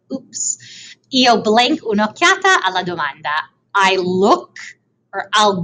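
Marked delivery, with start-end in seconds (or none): 0.1-0.9 soft breathy "Oops"; whispered oops. Real, not acted. Teacher catching herself after giving away the answer, whispering. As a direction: whisper it, caught out. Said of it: "I gave it away".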